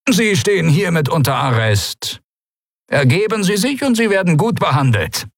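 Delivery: hohe, amtliche Figurenstimme; förmlicher Minister bei Verhaftung. A narrator's reading. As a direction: deutlich höher, eine Verhaftung verkünden. Veron verkündet die Verhaftung. (Minister, hoch und förmlich)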